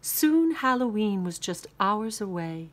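ordinary delivery

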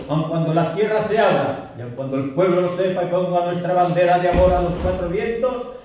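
high, sustained impassioned declamation; poem recitation. Acted. The lover vows love whatever the town says, soaring declamation. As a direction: drive it upward to the climax, defiant.

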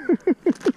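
high, bright burst of laughter after the catch; delighted laugh. Real, not acted. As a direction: laugh with real joy at the fish. Laughs after landing fish.

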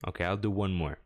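ordinary talk

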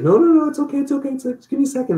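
high strained mock of the wife; wife in pain. Wife in pain begs husband not to call, high and strained. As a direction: pitch up high, pleading through pain. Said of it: "She's like".

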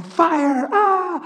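high, loud shout of Fire; excited cry. Retold or imitated. Praying over the girls at the table, excited. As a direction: call it out with joy.

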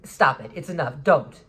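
loud, firm stop-it warning; sharp warning. Retold or imitated. Speaker telling off a stranger in a store, loud sharp warning. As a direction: shut him down hard. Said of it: "what did I say?".

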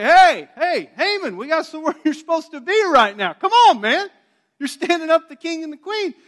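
high, loud and hurried; high, loud, fast servants' call; urgent loud summons. Retold or imitated. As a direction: hurry him along, shouting. Eunuchs hurry Haman along.

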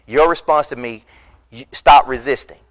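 ordinary talk

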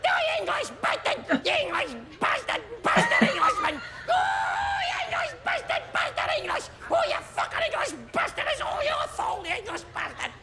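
very high, squealed Scottish yapping; yappy terrier. Acted. Comic mimicking a second type of Scotsman, yappy terrier voice. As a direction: yap Scottish insults like a little terrier. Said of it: "your little yappy terrier jock".

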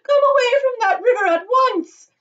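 very high, loud scolding; calling out. A narrator's reading. Mother ordering son from river, calling out. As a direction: yell the order at full pitch. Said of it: "called out Missus Gloop".

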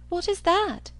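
high-pitched, eager question; wondering, suddenly curious; surprised cry. A narrator's reading. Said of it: "cried Catherine".

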